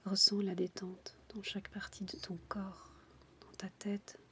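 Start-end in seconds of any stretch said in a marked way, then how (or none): none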